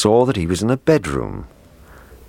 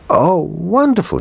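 put-on high, thrilled little boy; excited cry; 13 semitones higher, 4 dB louder than usual. A narrator's reading. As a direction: cry out in delight. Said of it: "he cried".

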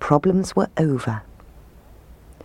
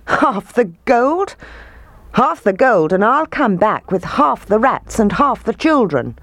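high, amused retort; merry mockery; 6 semitones higher than usual. A narrator's reading. Piper mocking the mayor's half offer, merry scorn. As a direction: toss the offer back, pitched up. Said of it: "replied the Piper with a merry laugh".

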